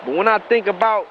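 usual speaking voice

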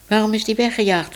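put-on quick curious girl; Minoes asking. A narrator's reading. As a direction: Minoes asks the cat, a hint of girlish eagerness. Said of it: "vroeg Minoes".

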